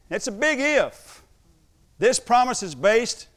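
high, loud, slow pulpit delivery; forceful, deliberate sermon proclamation; emphatic preaching. Real, not acted. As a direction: hammer each phrase out like a preacher, with pauses. Preaches on the word if.

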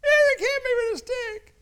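put-on high, loud tattling little boy; little son complaining to dad, high and loud; crying child. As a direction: whine it like a hurt kid telling on his brother. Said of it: "Brandon comes in screaming".

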